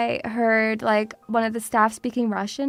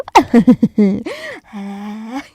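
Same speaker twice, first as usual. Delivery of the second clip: evil cackle; 3 semitones lower, 6 dB louder than usual. Pretend. Evil laugh after threat.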